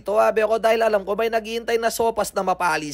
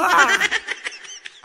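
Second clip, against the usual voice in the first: a quick real laugh after the joke; bright rising chuckle at his own line; short laugh; 11 semitones higher than usual. Real, not acted. Vlogger amused at his own joke to viewers, short laugh.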